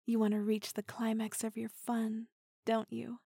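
intimate teasing whisper; close, breathy-free hushed tease. Acted. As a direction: lean in and whisper it.

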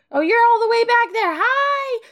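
high, loud call across distance. Acted. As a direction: holler a greeting to someone far behind. Calls to honey far behind.

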